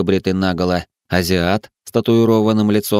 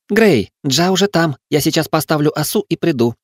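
put-on girl calling out; 9 semitones higher than usual. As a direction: call out briskly as the girl. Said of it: "крикнула Тара". (девушка окликает высоким голосом)

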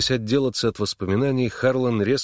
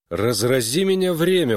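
raised, indignant-sounding exclamation; exclaiming; 5 semitones higher, 4 dB louder than usual. A narrator's reading. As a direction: burst out with a higher-pitched oath. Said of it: "воскликнул он".

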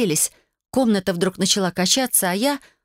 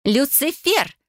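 higher-pitched angry cry of the name; furious yell; 7 semitones higher, much slower than usual. A narrator's reading. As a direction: call out the culprit in rage. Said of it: "раздался новый вопль Вайолет".

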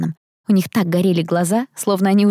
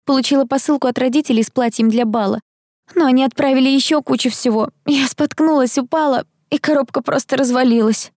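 put-on higher-pitched flustered girl explaining a mishap; light, high girlish character voice; chatty rich girl, flustered; 6 semitones higher than usual. A narrator's reading. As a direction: voice a friendly student in a higher pitch.

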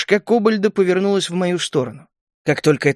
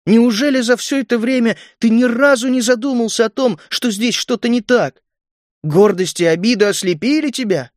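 high-pitched, pressing accusation; insistent, raised-pitch rebuke; relentless reproach; 7 semitones higher than usual. A narrator's reading. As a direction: keep hammering him with hurt questions. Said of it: "продолжала добивать его Галя".

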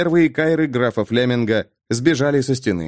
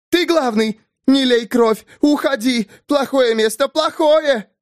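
shrill scream; 12 semitones higher than usual. Acted. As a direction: scream no like a madwoman. Gwenda continues frantic shouting.

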